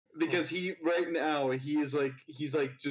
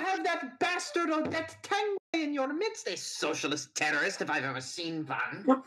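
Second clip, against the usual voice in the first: put-on German accent; 11 semitones higher, 5 dB louder than usual. Villain insults professor.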